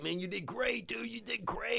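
high, hushed consoling praise; fake-reassure a bombed comic; soft pitying voice. Organizer consoling him after bombing.

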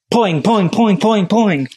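high, bouncy mock boing sound effect; cartoon boing sound. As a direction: bounce each poing up high like a spring. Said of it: "that's how they sounded in the episode too".

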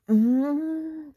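high, loud closed-mouth growl of exasperation; long pent-up "mm" of frustration; frustrated groan. Real, not acted. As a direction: squeal through shut lips, exasperated. Frustrated noise at that guy.

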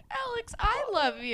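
very high, squeaky lovesick voice; hammed-up emotional wail, close to laughing; mock-tearful gushing. Fan pretends to confess her love to Alex, mock-tearful gushing. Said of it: "And she's crying".

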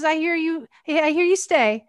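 lightly voiced Hawaiian pidgin speaker. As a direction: imitate a local Hawaiian guy asking casually. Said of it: "in his pidgin English".

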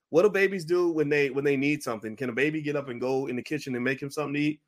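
ordinary talk

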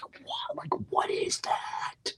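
hushed, drawn-out whisper of amazement; stunned disbelief. Retold or imitated. Father reacts to stranger naming his daughter, retold stunned whisper. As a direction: whisper it slowly, as if floored.

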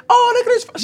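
mock admiring coo. Office visitors gushing over the bouquet, high mock coo. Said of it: "folks are saying".

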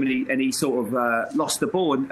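usual speaking voice

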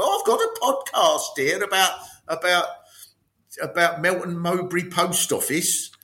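a hint of an old woman presenting her show; elderly podcaster. Old woman announcing her post office podcast, lightly voiced elderly podcaster. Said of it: "eighty-three-year-old women who live at the post office".

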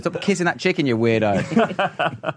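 high laughing jab; laughing tease. Real, not acted.

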